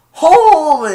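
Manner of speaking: high loud startled shout; imitated stunned exclamation; shocked cry. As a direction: blurt it in disbelief. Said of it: "Dizzy went".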